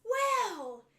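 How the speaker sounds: loud, slow incredulous teacher; mock outraged teacher, drawn out; teacher's indignant retort. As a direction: scold him like a shocked teacher. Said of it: "And the teacher goes".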